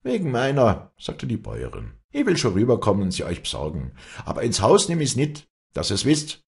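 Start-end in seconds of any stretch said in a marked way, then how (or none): 0.0-0.9 a higher, louder peasant woman; lightly voiced gruff farmwife; farmer's wife. 2.1-6.4 a higher, louder peasant woman; lightly voiced gruff farmwife; farmer's wife.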